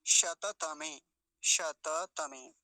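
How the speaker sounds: शततमे slow as "sha-ta-ta-me"; शततमे in clear syllables; full middle vowel. Shown as a demonstration. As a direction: give each त of शततमे its a. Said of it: "शत नहीं बोलेंगे".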